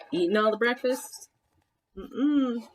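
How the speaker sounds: ordinary delivery, nothing marked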